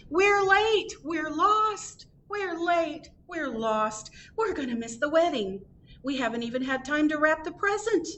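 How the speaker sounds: high-pitched cry of a family running late; yelling. A narrator's reading. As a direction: call out high, in a fluster. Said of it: "came running down the road yelling".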